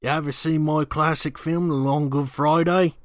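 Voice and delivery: a cheeky Cockney "oi mate"; Cockney impression. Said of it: "talking Cockney".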